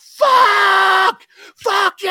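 enraged high-pitched scream, drawn out; screaming impression. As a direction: scream it like a man losing his mind with rage. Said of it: "he was screaming at the top of his lungs".

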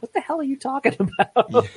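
high, laughing mock disbelief; incredulous laughing outburst. Retelling her reaction to movie clichés, high laughing disbelief. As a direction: toss it off half laughing, baffled. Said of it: "I'm like".

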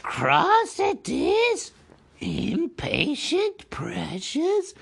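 very high, slow, drawn-out hiss of a creature; mock the hobbit's impatience, slithering and sly; Gollum hissing. A narrator's reading. Gollum scolding impatient Bilbo with a slow, high hiss. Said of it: "hissed Gollum".

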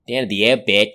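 a hint of Australian accent on "Down at the outback". Shown as a demonstration. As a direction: start the Australian accent with this line. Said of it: "I have to always restart with the same thing".

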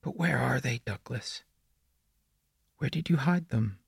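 put-on high, soft, eerie child voice; prepubescent boy. A narrator's reading. The boy in the woods greets Douglas, eerie and childlike. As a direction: speak softly up high, like a creepy little boy. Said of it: "his phlegmy, prepubescent voice".

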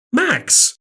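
high, shocked exclamation. A narrator's reading. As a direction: cry out the name in shock.

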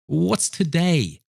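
high, drawn-out call; slow, high-pitched eager hail. A narrator's reading. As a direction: sing it out to someone below the window. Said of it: "calling downward to a boy".

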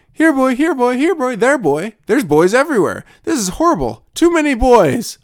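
high loud calling, growing frantic; calling a dog. Acted. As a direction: holler for the dog, then panic at too many. Horoscope skit calling a dog.

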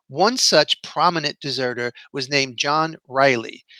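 normal talking voice

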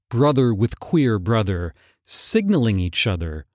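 ordinary delivery, nothing marked

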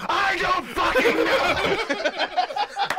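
very slow, high drawn-out wail; mock shouted answer; mock scream answer. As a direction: stretch it out as a fake scream. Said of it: "yeah, go".

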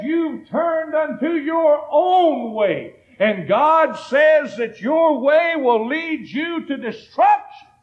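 slow, high, ringing preacher shout; full-force preaching. Acted. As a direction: hammer the message home to the congregation.

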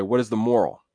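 usual speaking voice

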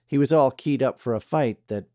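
ordinary delivery, nothing marked

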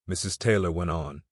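ordinary delivery

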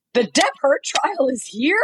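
high, rising incredulous question; imitated surprise. Retelling bro by the elevator learning of trial, mock surprise. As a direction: act out a passerby astonished by the news.

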